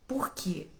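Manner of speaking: a sudden unvoiced whisper of the question. Pastor whispering the question to the congregation. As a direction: drop to a hushed whisper, as if telling a secret. Pastor's pause in his message.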